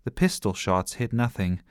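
ordinary delivery

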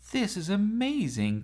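high thrilled exclamation; overjoyed racing; thrilled cry. Acted. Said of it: "cried Chloe".

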